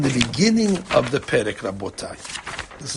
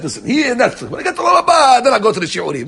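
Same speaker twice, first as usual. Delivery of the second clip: much higher, laughing put-on excuse; mock excuse; 7 semitones higher, 5 dB louder than usual. The Netflix guy brushing off the lessons, laughing mock excuse. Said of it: "you shouldn't think the guy says".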